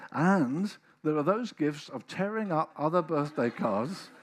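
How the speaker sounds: fervent sermon build; loud preaching. Acted. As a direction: drive the point home to the congregation. Preaching at full force.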